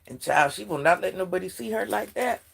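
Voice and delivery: ordinary talk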